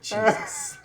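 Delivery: high, breathy exclamation; startled Jesus. Real, not acted. Reviewer reacting to the interruption, startled. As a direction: gasp it in shock.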